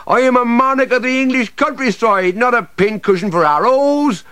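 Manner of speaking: high, loud call up to the soldiers; a tree shouting for protection; indignant call. Acted. Oak tree calling soldiers for protection.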